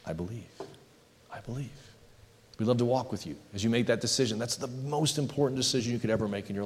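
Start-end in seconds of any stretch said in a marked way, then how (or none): 0.0-1.7 soft, hushed declaration of belief; barely voiced, private confession of faith; silently said. Shown as a demonstration. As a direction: say it softly, like a silent prayer. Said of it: "if you just say silently".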